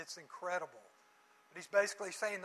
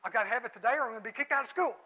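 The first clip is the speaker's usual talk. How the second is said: fast, louder, rushed panic from a daughter; urgent plea; 3 semitones higher, 7 dB louder, much faster than usual. Retold or imitated. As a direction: rattle it off as if the world is ending. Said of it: "it would be urgent".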